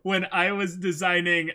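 excited. Real, not acted. Excited aside about a fantasy name.

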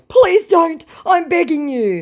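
high, loud frantic plea; hammed-up begging; mock begging. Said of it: "he goes".

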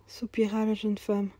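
usual speaking voice

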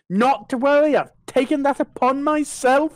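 high, lightly voiced smug tradesman; smug roofer. Roofer explaining to a family, lightly voiced smug. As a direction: play the cheeky roofer taking payment. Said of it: "some family just like".

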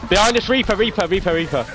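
sharp alarmed shout, then easing off; urgent callout. Real, not acted. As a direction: yell the warning, then drop. Urgent enemy callout behind team.

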